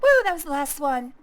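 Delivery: loud cheering imitation of the crowd; mock cheer. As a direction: whoop it up like a celebrating crowd. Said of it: "everybody's like".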